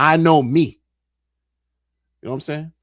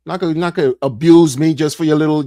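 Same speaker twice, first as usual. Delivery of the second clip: usual speaking voice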